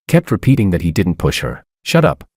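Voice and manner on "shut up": snapped. A narrator's reading. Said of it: "I snapped".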